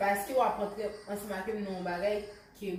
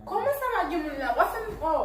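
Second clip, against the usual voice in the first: annoyed; 6 semitones higher, 5 dB louder than usual. Acted. Arguing against the boyfriend's claim.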